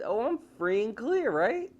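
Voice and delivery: high, smug self-assured voice; smug killer. Retold or imitated. Killer thinking he's free and clear, high and smug. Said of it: "So he thinks".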